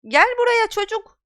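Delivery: high, loud alarmed shout. Acted. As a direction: yell at the boy to come back. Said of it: "diye bağırdı Madame Hook".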